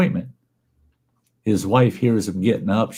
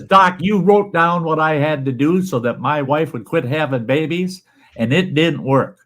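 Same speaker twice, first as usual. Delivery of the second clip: higher complaining patient voice; 7 semitones higher than usual. Acted. Patient protesting to his doctor, higher and aggrieved. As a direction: whine it at the doctor.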